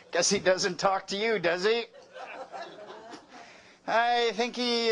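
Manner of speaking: usual speaking voice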